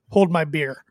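ordinary talk, nothing marked

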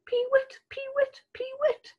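high squealing pee-wit bird call; lapwing cry. Acted. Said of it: "The lapwings squealed".

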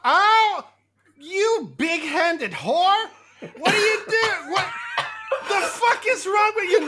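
high, loud indignant insults; slapped girl shrieking insults; outraged shriek. Acted. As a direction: scream back after being slapped. Slapped character shrieks insults back.

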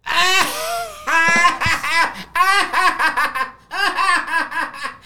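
loud high laugh, close to tears; high laughing fit. Real, not acted. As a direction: laugh until it almost cracks into crying. Dad laughs after punchline.

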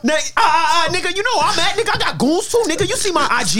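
hammed-up street bravado.

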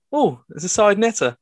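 high-pitched mother-in-law exclamation; mother-in-law quote. Retold or imitated. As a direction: pipe up as the mother-in-law spotting something. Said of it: "She's like".

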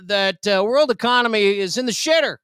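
high, hyped announcer voice; mock news anchor. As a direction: sell the scoop like breaking news.

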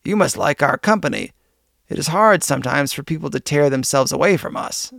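ordinary delivery, nothing marked

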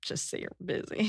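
hushed, voiceless whisper. Pretend. Echoing the co-host's advice to a friend, whisper. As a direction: whisper it like a secret tip.

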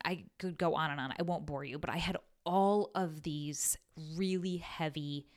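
ordinary delivery

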